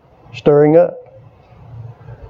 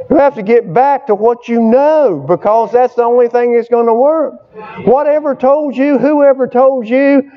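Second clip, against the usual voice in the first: higher-pitched, driving preacher delivery; fired-up pulpit exhortation; impassioned preaching; 6 semitones higher than usual. Real, not acted. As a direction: preach it hard, pitch up, stretching key phrases. Preaching at full force.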